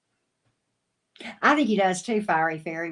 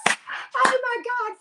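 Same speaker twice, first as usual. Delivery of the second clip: delighted gasp; 5 dB louder, much slower than usual. Real, not acted. Laughing at a chat comment.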